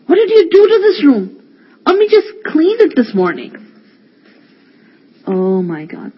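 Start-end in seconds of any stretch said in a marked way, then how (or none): 0.1-3.6 higher, louder, indignant cry; angry outburst. Acted. Older brother scolding the boys over the messy room, angry. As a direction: let the frustration burst out.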